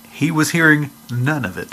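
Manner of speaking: normal talking voice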